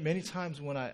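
normal talking voice

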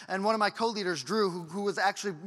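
ordinary talk

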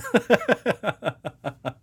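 gleeful laughter; high laugh. Real, not acted. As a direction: laugh hard. More laughter.

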